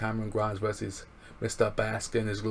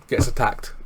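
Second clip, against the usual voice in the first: mock gasp; 4 semitones higher, 7 dB louder than usual. Mock surprise at attack.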